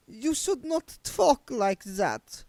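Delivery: high, slow, lofty reproach; haughty. A narrator's reading. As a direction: look down on him as you speak. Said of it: "said Doreen haughtily".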